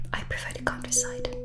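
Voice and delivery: ordinary talk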